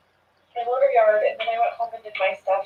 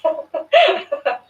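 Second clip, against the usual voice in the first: a quick embarrassed burst of laughter; short laugh; 9 semitones higher, 8 dB louder than usual. Real, not acted. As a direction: laugh it off, a little awkwardly.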